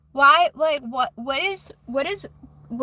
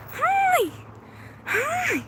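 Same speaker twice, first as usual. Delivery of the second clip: squeaky greeting; 13 semitones higher than usual. Pretend. Greeting viewers playfully on camera.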